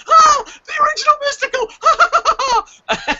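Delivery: very high, drawn-out squeal; fangirling. Retold or imitated. Wrestling fan imagining Mystico's arrival, fangirl squeal. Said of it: "I am fangirling for this".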